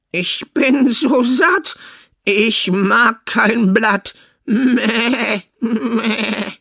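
high, slow bleating goat voice. Acted. The goat tells the son it is full, bleating. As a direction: stretch it out like a goat.

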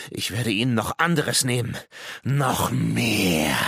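madman's threatening croak, swelling at the end; raving croak. Acted. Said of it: "krächzte der Mann und seine Stimme überschlug sich". (irre krächzende Drohung)

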